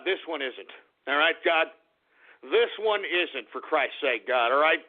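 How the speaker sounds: low, hushed pleading to God; solemn mock prayer. Host praying to God about the troll, low and solemn. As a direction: pray under your breath, hammed-up.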